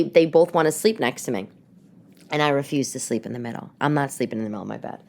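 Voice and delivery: ordinary talk, nothing marked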